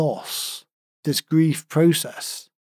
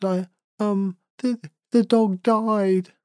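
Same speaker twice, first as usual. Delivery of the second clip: put-on higher, slow, halting little girl through tears; imitating sobbing child; 7 semitones higher, slower than usual. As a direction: mimic a small daughter choking out the words. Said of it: "and she was sobbing".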